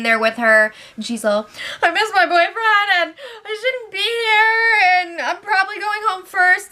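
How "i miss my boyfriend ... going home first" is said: hammed-up crying to the housemates; mock crying. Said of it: "She's crying in the lounge room".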